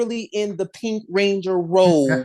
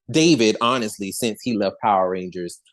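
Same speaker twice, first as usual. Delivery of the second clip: ordinary talk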